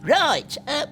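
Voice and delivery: a lightly voiced grandad telling him to buckle up. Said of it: "said Grandad".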